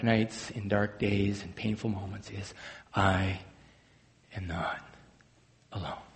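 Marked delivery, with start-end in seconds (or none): 2.9-6.0 slow, near-whispered I am not alone; hushed declaration. Shown as a demonstration. Preacher to the congregation, softly whispered. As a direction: let it drop to a breath at the end. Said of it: "Can you say that with me".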